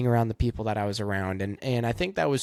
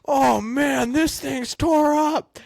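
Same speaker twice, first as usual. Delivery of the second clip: much higher and louder hammed-up mechanic, slow on the last words; shocked crew guy; 14 semitones higher, 5 dB louder, much slower than usual. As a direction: play a stunned crew member gawking at the wreck. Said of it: "some guy's like".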